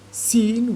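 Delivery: "seen" with a long, held EE. Shown as a demonstration. Teacher points out the vowel sound, long E in "seen". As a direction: stretch the EE in "seen". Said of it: "with a long E sound".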